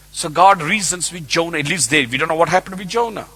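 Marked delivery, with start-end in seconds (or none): none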